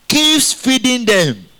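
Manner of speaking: slow, high, stressed answer; emphatic. Acted. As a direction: lean hard on every word. Preacher stressing God's care.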